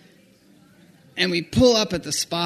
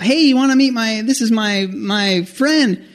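eager introduction; 6 semitones higher, 3 dB louder than usual. Retold or imitated. Friend introducing him at shop.

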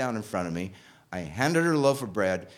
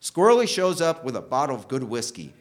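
ordinary talk

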